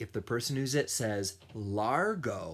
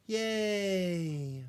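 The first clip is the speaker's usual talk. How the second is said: cheer; 9 semitones higher than usual. Acted. Cheering the baby.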